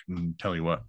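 low, soft mumbled Boomhauer; Boomhauer drawl. Retold or imitated. Imitating Boomhauer's catchphrase, low and soft. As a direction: trail off in a lazy mumble. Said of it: "he talks like that".

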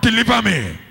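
high, loud prayer cry, long drawn out; full-force shout. Shown as a demonstration. Preacher leading congregation in a deliverance prayer, full-force shout. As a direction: shout it out with all your strength. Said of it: "you shout it twenty-one times".

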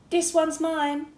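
high, loud eager shout; excited claim. A narrator's reading. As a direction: grab the bed with glee. Spencer claims the bed.

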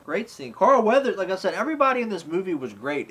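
ordinary delivery

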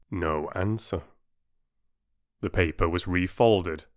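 usual speaking voice

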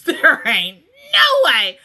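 drawn-out, loud cry of disbelief; emphatic disbelief. Real, not acted. Viewer balking at the show's pacing, emphatic disbelief. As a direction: stretch it into a loud protest. Protests show's impossible timeline.

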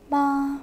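"Ma" held on one even pitch; level tone. Shown as a demonstration. Teacher showing third tone to class, unchanging level "Ma". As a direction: sustain "Ma" with no change in the voice. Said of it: "same inflection throughout".

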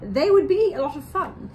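drawn-out playful read to little listeners; reading to children. A narrator's reading. Co-host reading her turn of the story to children. Reads book aloud.

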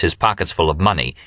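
ordinary delivery, nothing marked

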